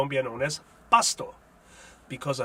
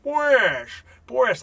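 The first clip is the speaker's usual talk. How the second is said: "Pues, pues" much higher and louder, falling sing-song; 8 semitones higher, 8 dB louder than usual. Shown as a demonstration. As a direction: sing "Pues, pues" like the Penguin or Cagney. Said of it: "sing-song translation".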